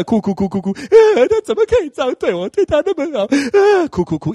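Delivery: high, wailing mock sob of a jilted girlfriend; mock heartbroken crying. Shown as a demonstration. Preacher acting out a jilted girlfriend, high mock wailing sob. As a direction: ham up the wail of someone just cheated on. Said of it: "示范给你看".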